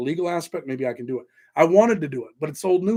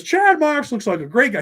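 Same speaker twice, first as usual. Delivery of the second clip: high, loud mock New York guy voice; mocking another YouTuber; 11 semitones higher, 6 dB louder than usual. Streamer mimicking a New York YouTuber's praise, high mock voice. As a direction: put on his excited praise, pitched way up. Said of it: "And he says".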